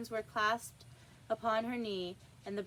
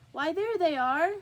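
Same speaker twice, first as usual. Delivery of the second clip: higher, louder triumphant cry; triumphant king; 7 semitones higher, 8 dB louder than usual. Acted. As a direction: crow over the tarts on the table. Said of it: "said the king triumphantly".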